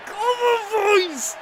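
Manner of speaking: very high, loud cheer; rallying shout. Real, not acted. Gamer rallying his squad with a loud, high cheer. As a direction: roar the team on. Rallying cry for trophy hopes.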